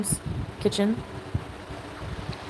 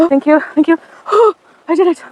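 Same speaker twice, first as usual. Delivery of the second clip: relieved; 9 semitones higher, 8 dB louder than usual. Real, not acted. Relief at reaching bottom.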